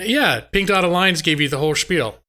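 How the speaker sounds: ordinary delivery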